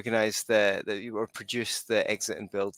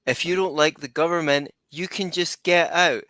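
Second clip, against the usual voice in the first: loud, punchy Texan slogan; Texan politician voice; 6 semitones higher, 8 dB louder than usual. Retold or imitated. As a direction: belt it out like a Texas politician. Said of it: "You've heard that from one Texan before".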